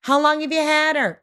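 high, carrying call without real force; yelling across the street. Acted. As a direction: holler a question to a faraway neighbor. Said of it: "This is me yelling from across the street".

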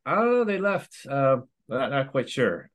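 high-pitched, noncommittal mock answer; vague reply. Colleague telling the principal he has no idea, vague. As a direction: imitate someone unsure, pitched well up.

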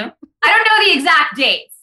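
mildly hammed-up interviewee brushing off the question; Hillary in interview. Mocking an interviewee dodging a question about dates, mock protest. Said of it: "Now I sound like Hillary in her New York Times interview".

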